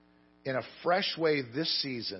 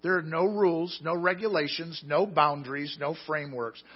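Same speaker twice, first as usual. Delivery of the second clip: higher, louder preaching build; fiery preaching; 4 semitones higher, 6 dB louder than usual. Acted. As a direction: proclaim it to the whole congregation. Preaching the verse at full force.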